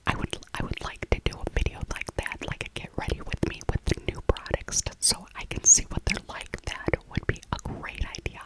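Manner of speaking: ordinary delivery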